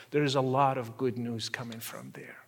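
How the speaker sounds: hushed unvoiced whisper, intimate. Real, not acted. Speaker urging listeners to see Muslims kindly, whispered. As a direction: lean in and whisper the good news. Closing plea, dropped to whisper.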